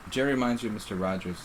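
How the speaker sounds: ordinary talk, nothing marked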